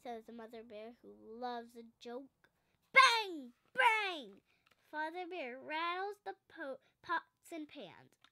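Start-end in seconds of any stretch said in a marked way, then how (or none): 2.9-3.5 loud bang. A narrator's reading. Sound of pots banged. 3.7-4.4 loud bang. A narrator's reading. Sound of pots banged.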